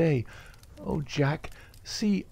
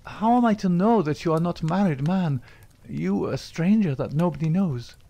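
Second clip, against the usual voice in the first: high, loud accusing burst that stays raised; jealous outburst; 8 semitones higher, 4 dB louder than usual. A narrator's reading. Etty accuses Jack of a secret wife, raised and jealous. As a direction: fling the suspicion at him.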